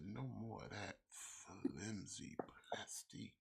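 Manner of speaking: slow, whispered seductive tone; sexy whisper. Pretend. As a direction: breathe it out slowly. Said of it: "talking in a sexy voice".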